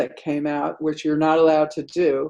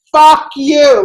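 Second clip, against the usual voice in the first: explosive curse, much higher and louder; angry shout; 10 semitones higher, 11 dB louder than usual. Retold or imitated. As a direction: hurl it from the kitchen. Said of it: "like this".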